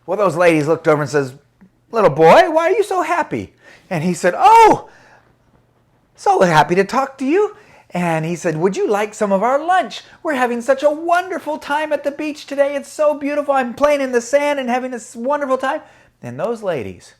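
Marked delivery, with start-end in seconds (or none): 1.9-3.5 put-on loud, rising-high old lady challenge; whining. Older lady questions a happy boy, whining. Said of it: "they stopped whining". 4.4-7.6 put-on high, loud, delighted child voice; happy little boy. Happy boy answers the older ladies, high and delighted. 8.6-15.8 put-on high, enthusiastic child voice; happy little boy. Happy boy offers lunch to the older ladies, high and enthusiastic.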